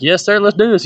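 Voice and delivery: high, eager yes sir; bright student jumping at the chance; eager reply. Retold or imitated. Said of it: "I'd be like".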